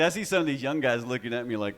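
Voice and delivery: ordinary talk, nothing marked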